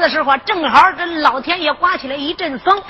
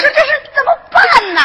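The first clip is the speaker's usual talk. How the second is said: panicked; 10 semitones higher than usual. Acted. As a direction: beg for an answer in fright. Panic over the news. (惊慌失措)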